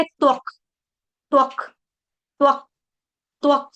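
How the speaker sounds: त्वक ending on a clipped half क; doubled k. Shown as a demonstration. Teacher drills the half क in त्वक. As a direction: close त्वक on a short stopped क. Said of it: "आधे क का उच्चारण करना".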